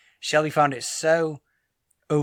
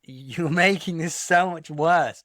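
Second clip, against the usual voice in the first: half-laughing mock exasperation, raised pitch; exasperated; 4 semitones higher, 4 dB louder than usual. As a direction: protest in disbelief, louder and higher. Acted exasperation at pushy staff.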